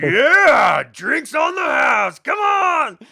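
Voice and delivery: high, shouted crowd celebration; rowdy cheer. Acted. Tavern crowd hears drinks are free, cheering loudly. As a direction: whoop it up loudly, stretching the words.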